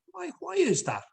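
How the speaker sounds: high, puzzled voice; high puzzled question. Retold or imitated. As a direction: ask it high and baffled. Retold question to himself.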